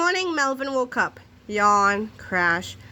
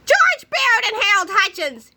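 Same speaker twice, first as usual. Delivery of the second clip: high, angry intercom shout; 11 semitones higher, 3 dB louder than usual. A narrator's reading. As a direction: bellow over the loudspeakers. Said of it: "he shouted over the loudspeakers".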